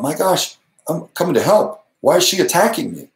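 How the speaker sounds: wondering why she attacks; baffled thought. Retold or imitated. Recalling a troll's attack, a touch baffled. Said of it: "And I thought".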